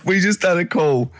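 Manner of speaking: usual speaking voice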